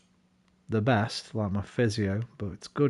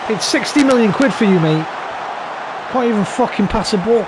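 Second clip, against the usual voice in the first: high, loud yell of disbelief at a useless player; frustrated shout; 10 semitones higher, 6 dB louder than usual. Real, not acted. Manager yelling at his pricey striker, high and loud. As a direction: bellow it like a furious fan after a missed pass.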